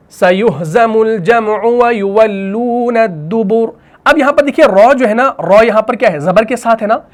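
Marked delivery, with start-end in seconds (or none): 0.1-3.8 slow, drawn-out tajweed recitation; Quran recitation. Shown as a demonstration. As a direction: chant the verse slowly. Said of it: "verse recited as example".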